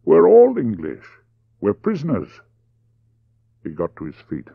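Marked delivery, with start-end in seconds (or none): 0.0-2.4 high, amused exclamation; lightly acted laughing reply. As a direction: say it as if laughing, pitched up.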